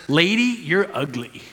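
mild parrot deadpan, falling away softly. Acted. Parrot insults the returning woman, lightly voiced parrot. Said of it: "Parrot looks right at her and says".

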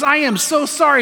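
high, earnest acted apology; apologetic. Pet store owner to insulted customer, apologetic. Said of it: "He goes".

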